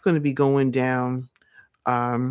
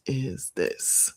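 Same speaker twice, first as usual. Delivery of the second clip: usual speaking voice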